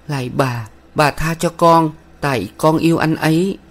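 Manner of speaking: put-on pleading girl. A narrator's reading. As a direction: beg humbly, like a frightened young woman.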